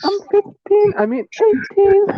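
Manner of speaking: put-on high, breathy new-girl act; girly voice. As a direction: play a shy lost girl.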